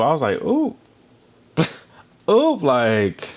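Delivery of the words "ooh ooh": much higher, laughing ooh of shock; a squealed, giggling ooh at the juicy gossip; scandalized ooh. Real, not acted. Reacting to "feeling is mutual.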